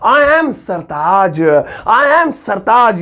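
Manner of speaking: drawn-out, very slow demo; slow classroom model; slow correct model. Shown as a demonstration. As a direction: say it slowly so students copy. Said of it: "ऐसे कर सकते हैं".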